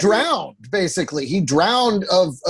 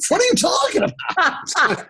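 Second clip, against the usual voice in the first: mock outrage; 7 semitones higher than usual. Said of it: "mock outrage".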